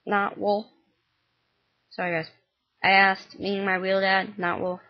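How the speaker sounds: ordinary delivery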